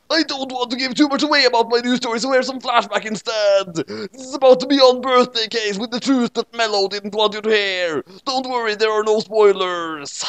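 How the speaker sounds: high, fast excited promo; hyped teaser. Acted. Ray teases his new story, high excited promo.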